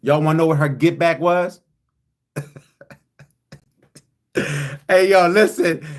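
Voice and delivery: ordinary talk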